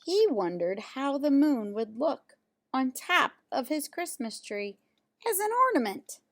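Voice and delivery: plain reading voice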